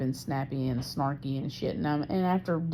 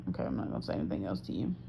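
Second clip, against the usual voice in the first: quiet backing off; 9 semitones lower, 8 dB quieter, faster than usual. Retold or imitated. Speaker retelling backing off from pissy partner, quietly. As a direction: drop low and soft, giving up the argument.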